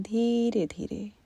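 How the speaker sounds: drawn-out, unhurried words; slow breath cue. Shown as a demonstration. Meditation teacher slowing the listeners' breath, drawn out. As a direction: stretch it to pace a slow inhale.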